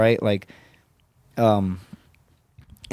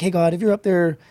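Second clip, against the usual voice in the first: higher-pitched tentative plea; bargaining with God; 7 semitones higher than usual. Retold or imitated. Wounded young marine asking God for time, bargaining. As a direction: call up and bargain for a favour. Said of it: "you start bargaining".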